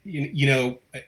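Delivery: tripping over repeated first words; halting, restarting as you search for words; stammering. Real, not acted. Host struggling to say what the closing means, stammering. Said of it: "I know I'm stammering".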